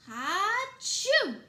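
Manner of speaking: a loud, high, explosive sneeze; huge sneeze. Acted. Wolf at the straw house door, loud explosive sneeze. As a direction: blast out one enormous sneeze. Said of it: "I sneezed a great sneeze".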